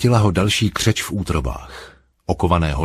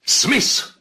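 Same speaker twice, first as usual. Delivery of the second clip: much higher barked shout; roaring. A narrator's reading. Telescreen voice roaring at the prisoner. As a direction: roar the name from the screen. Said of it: "zařval hlas z obrazovky".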